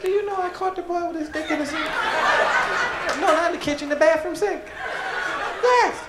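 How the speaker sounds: very high, laughing woman's voice on the phone; mother telling relatives on the phone, laughing; gossiping mother. Retold or imitated. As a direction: gossip in a shrill-high mom voice, giggling. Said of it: "get on the phone and say".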